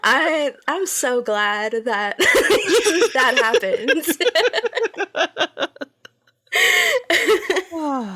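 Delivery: words broken up by real laughter; real laughing fit. Real, not acted. Cracking up at the joke.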